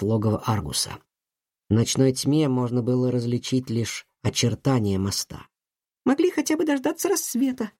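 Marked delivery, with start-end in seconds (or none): 6.0-7.7 put-on angry girl. A narrator's reading. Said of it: "сердито заметила Лив".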